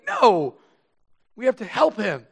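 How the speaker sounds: the wife pleading with her husband, firmly; wife insisting. Retold or imitated. Wife insists on helping man.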